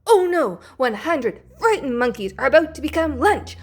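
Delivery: ordinary delivery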